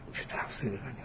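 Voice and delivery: whispered ironic aside, voice dropped away; mock admiration breathed under the breath; sarcastic whisper. Real, not acted. Cleric mocking a strange Quran commentary, sarcastic whisper.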